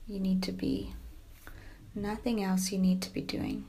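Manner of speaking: soft yoga nidra voice with long pauses; guided meditation. Acted. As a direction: gently settle the listener into stillness, speaking softly.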